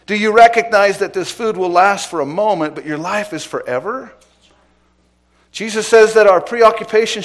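loud, rising preacher's call, driving each point home; full-force preaching. Acted. Pastor exhorting his congregation, preaching at full force. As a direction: preach it out to the whole room.

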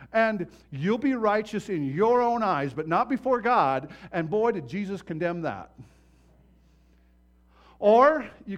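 loud, driving pulpit preaching; preaching at full force. Acted. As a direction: hammer each point home to the congregation.

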